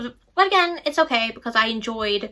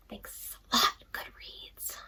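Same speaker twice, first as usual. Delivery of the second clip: sarcastic whisper; 13 dB quieter, slower than usual. Real, not acted. Aside grumble at the app.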